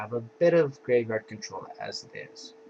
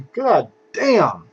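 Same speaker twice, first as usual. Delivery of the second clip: high, loud impressed shout; loud exclamation; 8 semitones higher, 13 dB louder than usual. Real, not acted. Exclaims at card strength.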